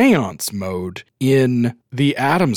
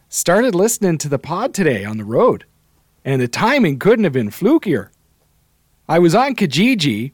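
put-on higher-pitched mid-Canadian regional accent; Wisconsin-y Canadian accent; 5 semitones higher than usual. Reading a listener's message from middle Canada, Wisconsin-y Canadian accent. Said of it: "read it in your Canadian accent".

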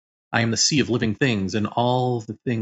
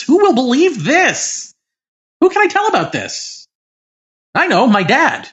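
giddy excitement; 13 semitones higher, 5 dB louder than usual. Retold or imitated. Giddy after the experience.

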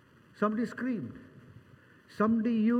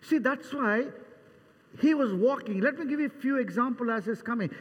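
normal talking voice

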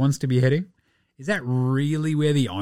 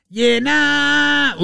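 high, loud yeah nah; shouted catchphrase; 12 semitones higher, 6 dB louder than usual. Acted. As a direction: blast the segment name.

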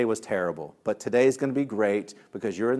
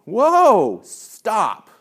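high, loud halting call; child interrupting; 8 semitones higher, 9 dB louder than usual. Retold or imitated. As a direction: imitate a kid stopping the teacher short. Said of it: "had asked me".

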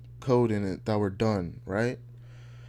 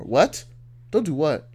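heated argument; 8 semitones higher, 5 dB louder than usual. Retold or imitated. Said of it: "You're like".

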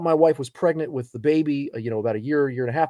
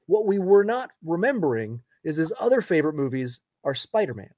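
ordinary talk, nothing marked

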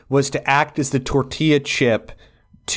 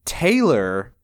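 ordinary delivery

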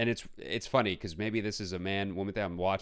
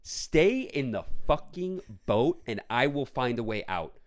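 louder, slow, indignant scolding; retold rant; 4 semitones higher, 4 dB louder, slower than usual. To Tina about the movie, exasperated slow lecture. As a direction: lay down the law to her. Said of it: "I'm like".

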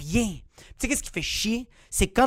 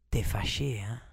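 lightly voiced old grandma, lower and softer; a hint of a sly old grandmother teasing; 9 semitones lower, 7 dB quieter than usual. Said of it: "elle me dit". (grand-mère taquine, à peine jouée)

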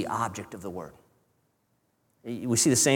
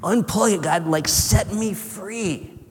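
higher pleading cry to God; David crying out; 7 semitones higher, slower than usual. Retold or imitated. David begs God to set him free, high pleading. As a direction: beg God to unplug it, lifting up. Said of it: "And he said".

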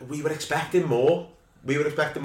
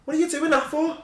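high-pitched Scouse pundit rant; Jamie Carragher. Retold or imitated. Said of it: "you go full Jamie Carragher".

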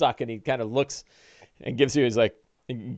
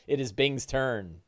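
normal talking voice

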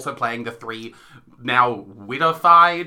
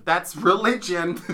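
laughing; 3 semitones higher, slower than usual. Real, not acted. Repeats friend's punchline laughing.

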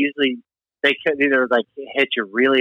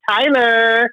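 high, whiny drawn-out Tyler; 9 semitones higher than usual. Shown as a demonstration. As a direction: whine the name. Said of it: "when you say it with a whiny voice like that".

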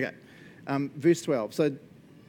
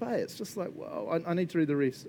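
usual speaking voice

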